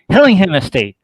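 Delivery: ordinary delivery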